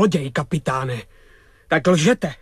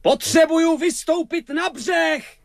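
raised, higher-pitched call to shore. Acted. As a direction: call urgently across the water. Calls from boat to soldier. (volání na břeh)

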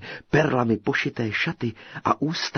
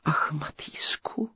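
slow breathy whispered sigh; 6 semitones higher, 4 dB quieter, slower than usual. A narrator's reading. Captive girl sighing for the hidden tailor, whispered. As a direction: sigh his name in longing. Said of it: "vzdychla knížecí dcera".

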